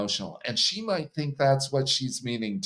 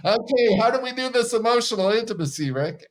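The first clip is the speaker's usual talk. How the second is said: much higher, fast mock asking; mock eager husband; 10 semitones higher, 4 dB louder, faster than usual. Husband asking Rick for help, high fast mock voice. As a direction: rush it like a lost husband.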